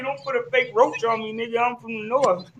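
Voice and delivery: ordinary delivery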